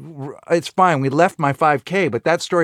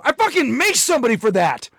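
high-pitched, indignant comic outburst; mock outrage; 8 semitones higher than usual. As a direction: act outraged at an ungrateful reaction. Acting out indignant gift giver.

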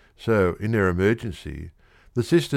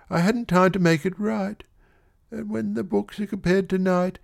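put-on much higher, anxious young woman confessing; tearful girl; 10 semitones higher than usual. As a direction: spill it all out near tears. Girl confesses between sobs.

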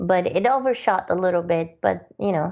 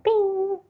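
chime imitation. Imitating app's chime sound.

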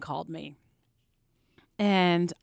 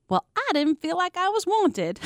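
put-on Southern accent; 8 semitones higher than usual. Airport girl on the phone, imitated in a Southern accent. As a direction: mimic a young woman's Southern lilt, lightly. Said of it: "That sounded kinda Southern".

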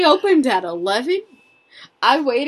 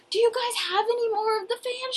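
hopeful ask; 10 semitones higher, 8 dB quieter, faster than usual. Retold or imitated. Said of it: "And I was like".

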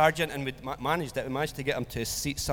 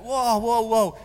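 startled protest; 6 semitones higher, 6 dB louder, slower than usual. Retold or imitated. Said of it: "I was like".

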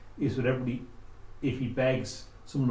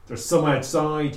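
loud call; 6 semitones higher, 10 dB louder than usual. Acted. Drunk man shouts through door.

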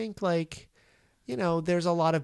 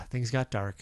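normal talking voice

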